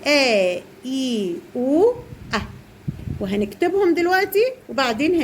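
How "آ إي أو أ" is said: slow, carefully shaped آ إي أو أ; short vowel sounds. Shown as a demonstration. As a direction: model each short vowel آ then إي then أو then أ, slowly. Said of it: "هنذاكر كده لأولادنا".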